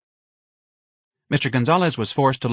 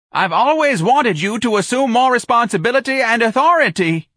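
much higher, louder frantic scream; screaming manager; 14 semitones higher, 7 dB louder than usual. A narrator's reading. Office manager screaming at the old clerk. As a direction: scream it in desperation. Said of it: "the office manager screamed".

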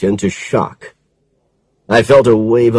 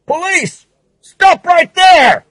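high, loud shouted command; police shout; 3 dB louder, slower than usual. A narrator's reading. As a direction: bark the order to stop. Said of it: "A shout".

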